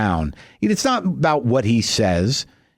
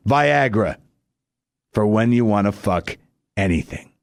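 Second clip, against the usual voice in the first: ad announcer voice; 2 semitones lower than usual. Pretend. Comic closing the fake Viagra ad, announcer voice. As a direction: deliver the slogan like a commercial voiceover. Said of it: "advertising angle that goes something like this".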